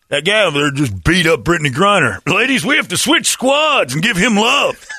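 high, loud mock announcement; hammed-up rallying call. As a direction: call out to the whole team in an exaggerated high voice. Shouted announcement to the team.

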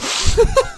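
high-pitched laugh. Real, not acted. Co-host cracks up after the fake interview, high laugh.

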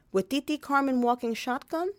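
ordinary talk

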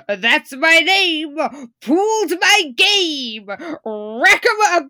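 slow, loud hammed-up Italian gangster; slow gangster swagger. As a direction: drawl it like a movie mobster. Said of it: "he says".

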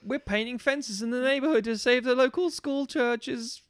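put-on high, slow, earnest do-gooder kid; wholesome 1950s kid. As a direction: parody a squeaky-clean fifties teen. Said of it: "Gee willikers, mister".

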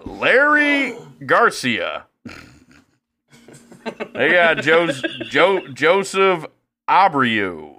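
high, slow, stretched mock announcer on the names; a lightly voiced old broadcaster mangling names. Imitating an old broadcaster botching player names, mock. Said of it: "Who else's name would he fuck up".

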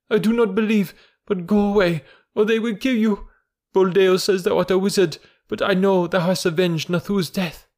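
high-pitched anguished woman's voice; high, wailing maternal appeal; distraught mother crying out. A narrator's reading. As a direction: plead urgently with your son. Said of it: "ran across the herd and cried".

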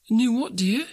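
high-pitched wifely query; puzzled wife. Acted. Wife questions Bob in story.

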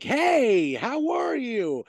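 high, drawn-out mock greeting; super loud. Snubbed housewife makes herself heard nearby, super loud. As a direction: imitate someone talking pointedly loud to get noticed. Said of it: "super loud".

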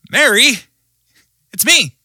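playful prank reveal, high and loud; high, loud, teasing call; Jesus. Acted. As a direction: sing out a gleeful "surprise, it's me". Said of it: "is pranking her".

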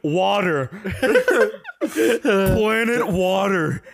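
a slow, laughing "Planet Water". Real, not acted. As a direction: drag it out through laughter. Laughing at the joke.

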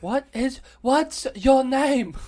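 very slow, loud and high, each word stretched; over-enunciate it for a barber who can't hear; slow loud repeat. Retold or imitated. Retelling asking the deaf barber his name: slow loud repeat. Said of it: "I was, I was like".

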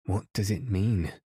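usual speaking voice

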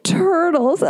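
high, drawn-out incredulous mimicry; mocking musician. Musician making fun of her love of turtles, mocking. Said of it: "he, like, laughed with her".

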